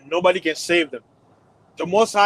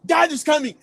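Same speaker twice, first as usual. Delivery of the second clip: put-on kid's alarm; 9 semitones higher than usual. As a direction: sound the alarm like a child who hears Dad. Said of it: "or you".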